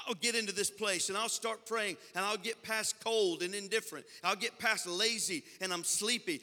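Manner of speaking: loud, driving Pentecostal preacher; full-force preaching. Real, not acted. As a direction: build momentum, phrase upon phrase.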